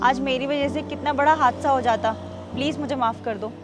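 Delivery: quietly owning up to a big mistake; remorseful apology. Acted. Woman softly begs the guard and neighbour for forgiveness, remorseful.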